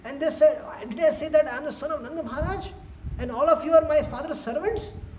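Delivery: high, hurt whine; mock tearful complaint. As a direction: wail mildly like a rejected child. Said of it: "he was crying".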